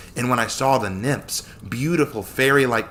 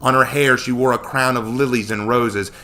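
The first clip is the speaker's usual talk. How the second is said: ordinary delivery, nothing marked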